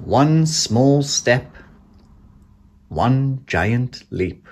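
usual speaking voice

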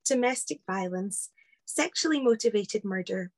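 usual speaking voice

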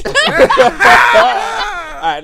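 a high, loud shout through laughter; laughing shout. Real, not acted. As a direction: yell it mid-laugh. Bursts out laughing at friend.

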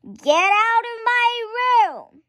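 high, loud bratty yell; shrieking little girl; child's shout. A narrator's reading. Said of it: "So I shouted".

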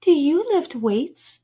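put-on sweet girl voice; cooing. A narrator's reading. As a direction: coo it admiringly. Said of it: "she cooed".

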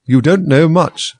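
loud, slow, scornful put-down; rude, dismissive. Acted. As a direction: belittle her slowly. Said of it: "the tone of this remark".

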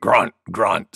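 low spoken boar grunts; grunting boar. A narrator's reading. Wild Boar joining the sneezing contest, low grunting. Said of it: "said Wild Boar".

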